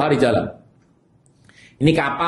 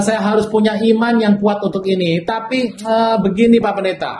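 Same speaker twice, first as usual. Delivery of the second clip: higher, hesitant voice of a wavering believer; doubting churchgoer; 6 semitones higher than usual. Retold or imitated. Churchgoer explaining doubts to the pastor, higher and hesitant. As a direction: imitate a churchgoer hedging with the pastor. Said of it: "Anda mungkin berkata".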